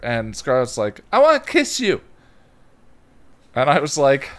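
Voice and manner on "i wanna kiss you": put-on high, sweet toddler plea. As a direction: ask for a kiss like a two-year-old. Toddler voiced in story.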